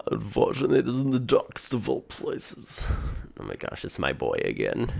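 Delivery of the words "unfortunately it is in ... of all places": theatrical mysterious figure. Acted. Mysterious boy laments the darkest places, theatrical. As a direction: lift it up into a grand put-on voice. Voices game character dialogue.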